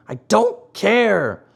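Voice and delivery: put-on high, drawn-out childish refusal; stubborn defiance. A narrator's reading. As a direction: dig in, stretching each word. Pinocchio stubbornly defying the fairy.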